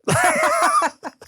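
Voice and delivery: squealing high laugh. Real, not acted. Big laugh.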